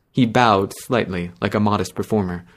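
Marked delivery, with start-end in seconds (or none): none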